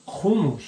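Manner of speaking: HUMMUS with a throaty kh at the start; "hummus" as khu-MUS, Israeli style; Israeli pronunciation. Shown as a demonstration. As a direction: say "hummus" the Israeli way, opening on a rasped kh. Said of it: "in Israel, I think they pronounce it".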